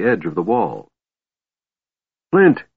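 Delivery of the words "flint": high, loud call to a friend; shout. A narrator's reading. Tass calling Flint over on the wall, shouting. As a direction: shout the name out, pitched well up. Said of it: "he shouted, waving his arms".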